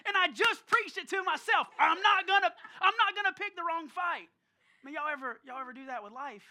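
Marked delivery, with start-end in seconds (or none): 0.1-4.3 higher, much louder preacher's cry; full-voiced, comic self-reproach; exasperated outburst. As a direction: belt it out in mock exasperation. Preaching his failed vow at himself.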